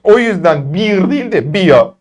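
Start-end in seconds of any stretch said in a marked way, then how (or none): 1.5-1.9 slow "Bia", with the r turned to "a"; "Bier" said as "Bia". Shown as a demonstration. Teacher showing class how to end "Bier". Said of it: "A diye okuyacaksınız".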